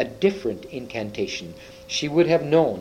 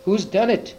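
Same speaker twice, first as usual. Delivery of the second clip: higher-pitched cry of a narrated question; mild outcry; 7 semitones higher than usual. A narrator's reading. Susan demands who broke the table, mild outcry. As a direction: cry it out, restrained storyteller style. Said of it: "cried Susan".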